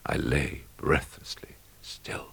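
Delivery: slow, hushed whisper; a slow, tense whisper; breathless whisper. A narrator's reading. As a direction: barely let the words out. Holding still as rats approach.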